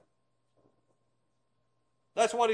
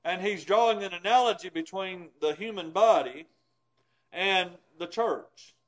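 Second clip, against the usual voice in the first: ordinary talk, nothing marked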